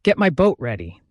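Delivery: restrained shout of command; shouted order. A narrator's reading. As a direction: call an order to the crew. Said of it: "Ahab shouted".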